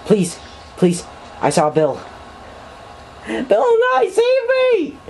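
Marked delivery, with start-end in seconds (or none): 3.3-5.0 high-pitched squeaky plea for rescue; cartoonish high-pitched cry for help. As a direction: beg in a tiny high voice. Begging for rescue in game.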